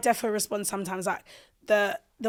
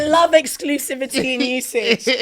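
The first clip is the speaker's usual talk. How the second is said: laughing; 4 semitones higher, 5 dB louder, slower than usual. Real, not acted. Joking about contract terms.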